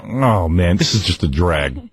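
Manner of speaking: low-pitched weary complaint; dad grumbling to himself. Retold or imitated. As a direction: imitate a fed-up father talking to himself. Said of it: "my old man was probably saying to himself".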